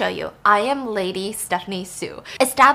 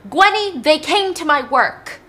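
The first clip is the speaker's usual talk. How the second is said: raised, high-pitched angry dad outburst; angry father; 6 semitones higher, 6 dB louder, slower than usual. Acted. Dad confronting his daughter while belting her, angry shout. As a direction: shout it accusingly as the enraged dad. Furious dad acted in story.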